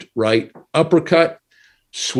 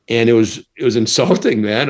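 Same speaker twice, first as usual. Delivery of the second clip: normal talking voice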